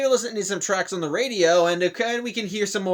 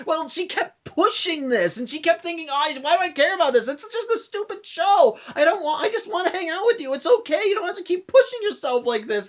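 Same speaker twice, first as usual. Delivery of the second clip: much higher, imitated tearful self-reproach; crying; 10 semitones higher than usual. Peridot blaming herself while hugging Steven, imitated tearful, much higher. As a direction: voice her as if about to cry, pitched well up. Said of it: "as she actually starts crying".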